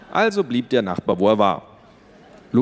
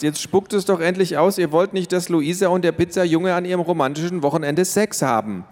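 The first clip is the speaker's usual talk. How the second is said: higher pitched, impatient and blunt; exasperated outburst; 9 semitones higher than usual. A narrator's reading. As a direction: blurt it out to end the awkwardness. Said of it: "rief Max".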